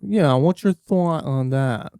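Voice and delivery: slow, drawn-out podcast host; podcaster voice. Shown as a demonstration. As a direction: lean in like a chummy podcaster. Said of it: "there's, like, the podcaster voice".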